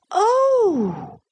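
sudden high-pitched "oh"; high, startled-sounding exclamation. A narrator's reading. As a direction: burst out in a high child's voice. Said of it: "very loud and sudden".

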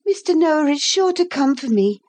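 put-on high, earnest young girl; a girl reassuring herself in the flood; little girl voice. A narrator's reading. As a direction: speak up as a sensible child. Narrator voicing Lucy.

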